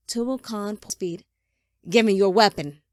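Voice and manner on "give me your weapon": loud, demanding order; forceful command. A narrator's reading. Father demanding his son's weapon, loud command. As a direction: order it at full voice.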